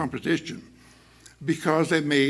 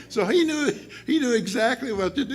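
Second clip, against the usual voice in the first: laughing; 10 semitones higher than usual. Real, not acted. Teacher laughing while telling the class the story. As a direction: laugh through it, tickled by the story.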